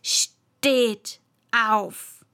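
loud piercing command. Acted. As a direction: pierce the air with the order. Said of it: "mit einer durchdringenden Stimme".

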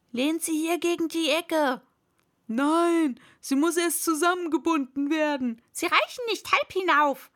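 bustling crowd exchanging shouts; busy shouting. A narrator's reading. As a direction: call out instructions to the crew. Several workers shout over each other.

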